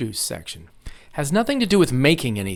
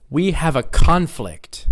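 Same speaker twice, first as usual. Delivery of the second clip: slow line landing on CON-flict; first-syllable stress; 3 semitones higher, 4 dB louder, slower than usual. Shown as a demonstration. Teacher models the noun in a sentence, CON-flict. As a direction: hit the CON in "conflict". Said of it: "nouns are stressed on the first syllable".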